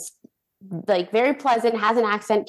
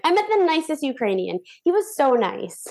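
high, swooning delight; telling everyone about the nice Ukrainian boy, giddy; giddy gushing; 8 semitones higher than usual. Retold or imitated. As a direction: gush like a smitten girl. Running around gushing.

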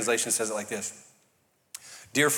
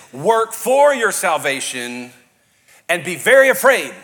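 slow, loud, stress on for; a loud turn-or-burn warning, mock version; mock fire-and-brimstone; 3 semitones higher, 8 dB louder, slower than usual. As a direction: ham up a scary preacher. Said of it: "we've heard it like this".

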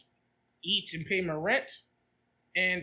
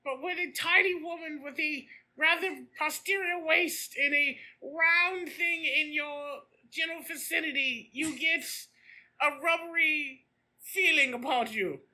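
higher mock olde-English voice; lightly voiced pompous old English gent addressing Katie; hammed-up old English gent; 5 semitones higher than usual. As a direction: ham up a pompous officer reminiscing. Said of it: "you just sound like a Monty Python character".

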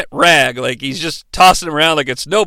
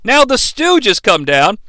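ordinary talk, nothing marked

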